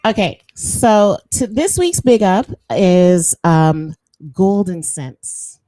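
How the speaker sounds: loud, slow drawn-out announcement; big announcement. Acted. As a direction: announce it like a grand prize. Announcing segment winner.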